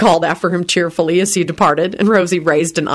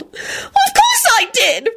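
high, slow, tearful angry squeal; much slower than usual. A narrator's reading. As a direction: squeal it back through tears. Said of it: "Rosie squealed angrily".

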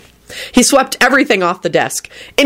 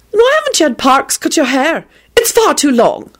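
high-pitched fussy nagging; irritable; 8 semitones higher than usual. A narrator's reading. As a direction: scold him in a high, irritated voice. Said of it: "she responded irritably".

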